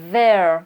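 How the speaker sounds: there, loud and falling, TH buzzing in the throat; vibrating TH. Shown as a demonstration. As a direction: punch out there with a voiced TH. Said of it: "qui va vibrer un petit peu dans la gorge". (there fort, TH qui vibre)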